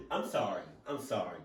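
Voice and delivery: normal talking voice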